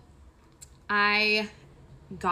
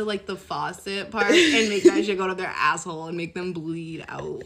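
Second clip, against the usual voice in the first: ordinary talk, nothing marked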